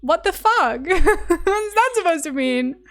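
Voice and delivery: indignant laughing what the fuck; mock outrage. Real, not acted. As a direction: play it up as if insulted, laughing. Offended by remark on accent.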